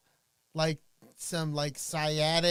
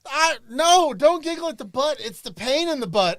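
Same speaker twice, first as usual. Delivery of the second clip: excited protest; 7 semitones higher, 12 dB louder than usual. Real, not acted. Protesting butt pain.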